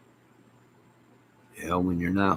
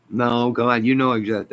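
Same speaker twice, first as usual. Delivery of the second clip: ordinary talk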